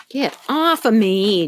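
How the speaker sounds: plain reading voice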